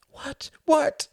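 hushed, then high and loud; desperate mother. A narrator's reading. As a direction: press urgently, jumping from breath to cry. Desperate mother presses him.

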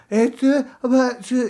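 mumbled nonsense. Retold or imitated. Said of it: "yo le estaba diciendo".

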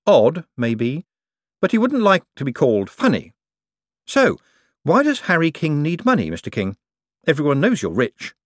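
high, eager banker politeness; Moist, polite banker. A narrator's reading. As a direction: keep the customer sweet. Moist speaks.

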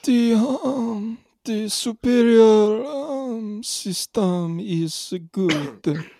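high, slow hesitant; halting Luigi voice. Acted. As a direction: stumble through the memorized line. Luigi stumbles, higher voice.

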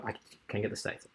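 muttered under the breath; whisper. Real, not acted. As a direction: whisper it to yourself. Muttering while working it out.

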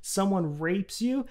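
usual speaking voice

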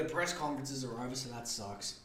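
ordinary talk, nothing marked

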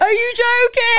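real shock, voice shooting up; shocked gasp. Real, not acted. Reacting to police being called.

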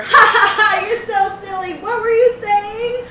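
high, loud fake cackle; fake laughter. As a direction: laugh over him to cover. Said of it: "cover him with laughter".

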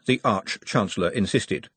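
ordinary delivery

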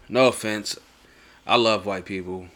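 usual speaking voice

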